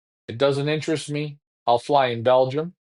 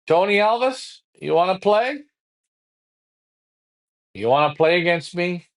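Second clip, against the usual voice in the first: higher, rising taunt daring him to play; taunting challenge; 5 semitones higher than usual. Acted. Pigeon flyer taunting his rival to race him. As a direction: call him out, egging him on.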